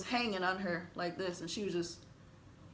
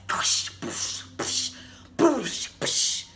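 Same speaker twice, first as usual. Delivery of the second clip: whispered crash noises; 3 semitones higher, much slower than usual. Retold or imitated. Glass crash sounds.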